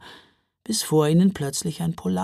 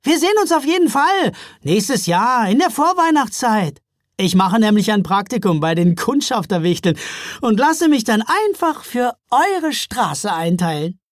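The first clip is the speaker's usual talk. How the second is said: cheerful; 6 semitones higher, 5 dB louder than usual. A narrator's reading. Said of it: "Micke lachte".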